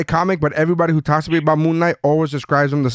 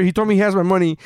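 usual speaking voice

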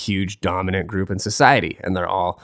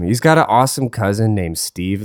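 usual speaking voice

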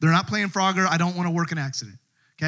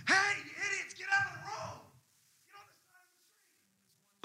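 yelling at the crowd; 13 semitones higher, 13 dB quieter than usual. Retold or imitated. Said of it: "And I just yelled at them".

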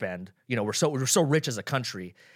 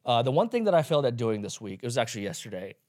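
normal talking voice

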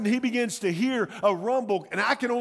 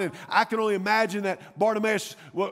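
ordinary delivery

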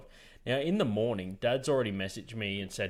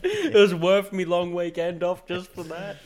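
slow, high, loud through laughter; mock satisfied mate, laughing; 6 semitones higher, 8 dB louder, slower than usual. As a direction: draw it out while laughing. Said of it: "He go".

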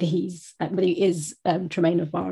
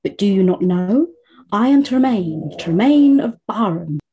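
grand dramatic entrance; 2 semitones higher, 7 dB louder than usual. Acted. Quoting a favourite character's entrance, grandly declaimed. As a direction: announce your name with great pomp.

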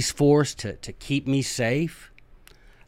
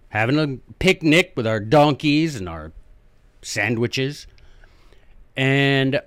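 mock outrage; 3 semitones higher, 7 dB louder than usual. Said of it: "They're like".

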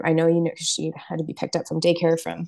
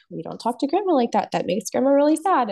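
lightly imitated grandma scolding, pitched higher; retold gentle telling-off in a higher voice; lecturing grandma; 6 semitones higher, 4 dB louder than usual. Daughter retells her mom correcting the child, lecturing grandma voice. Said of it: "my mom went into the whole thing".

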